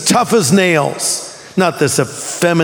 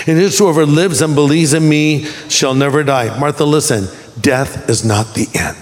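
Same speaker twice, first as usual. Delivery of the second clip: low, measured voice of Jesus consoling; calm, solemn reassurance; 5 semitones lower than usual. Acted. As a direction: reassure her gravely, pitched low. Preacher voices Jesus consoling Martha.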